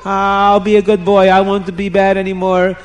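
hammed-up storybook begging, each please stretched. A narrator's reading. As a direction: beg desperately for your life. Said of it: "he's begging the queen".

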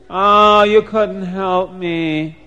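loud "oh" sinking into a slow moan; despairing manager. Acted. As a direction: sigh it out hopelessly, dragging the words. Despairing manager dismisses the tailors' offer.

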